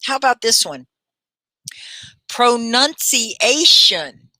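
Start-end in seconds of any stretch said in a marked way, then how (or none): 2.3-4.3 very slow, syllable-by-syllable "Pronunciation"; teacher sounding out "Pronunciation" slowly, pitched up; slow pronunciation model. Shown as a demonstration. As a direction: draw out "Pronunciation" for learners to copy. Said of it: "Should we shadow some words?".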